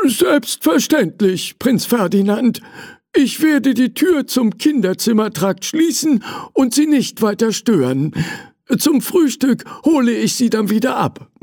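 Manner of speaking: put-on an obliging old servant reassuring the prince; servant Hugo. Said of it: "sagte er".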